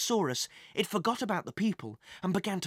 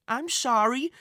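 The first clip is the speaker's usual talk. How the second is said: put-on small, higher-pitched boy's voice; sheepish apology; 7 semitones higher, 3 dB louder than usual. A narrator's reading. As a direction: apologise like a boy who knows he's in trouble. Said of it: "said Thomas sheepishly".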